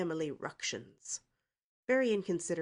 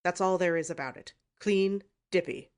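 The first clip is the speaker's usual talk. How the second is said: angry snort; 2 semitones higher, 8 dB louder than usual. A narrator's reading. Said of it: "he snorted angrily".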